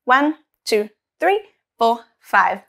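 put-on counting along with a child; teaching a child to count. Shown as a demonstration. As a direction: count aloud for kids to join. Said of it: "Let's double check".

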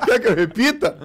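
high, loud laughing question. Real, not acted. Retelling the reply to friends, laughing loud. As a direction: laugh out the words.

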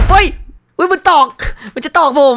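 alarmed cry. Real, not acted. Threatened by game figure.

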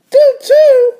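very high, loud little-sister voice. Acted. Little sister contradicting her brother, high and loud. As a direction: squeal it back as the little sister. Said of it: "says D W".

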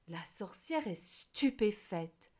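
usual speaking voice

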